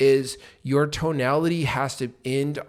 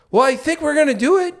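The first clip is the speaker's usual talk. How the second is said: much higher and louder, "do it" rising like a question; upward inflection; 12 semitones higher, 7 dB louder than usual. Shown as a demonstration. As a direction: sound unsure, ending on a lift. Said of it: "I'm going upwards".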